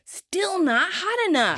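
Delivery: high, slow, drawn-out frustration; frustrated. Retold or imitated. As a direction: complain loudly to yourself, stretching the words. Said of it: "Joey frustratedly heads for the door".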